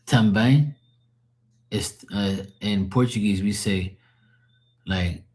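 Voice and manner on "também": "Também" with a questioning rise on the last syllable; imitated pronunciation. Shown as a demonstration. Echoing how you said it, rising imitation. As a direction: lift the end of "Também", quoting it back. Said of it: "see how you said, like".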